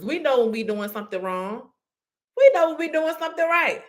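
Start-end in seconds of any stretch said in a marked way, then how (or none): 2.4-3.8 pitched well higher, leaning into we; lifted emphatic contrast. Shown as a demonstration. Preacher to audience, lifts higher for right. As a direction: raise the pitch to contrast with the wrong.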